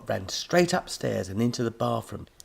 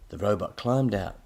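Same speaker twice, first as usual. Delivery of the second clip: ordinary delivery, nothing marked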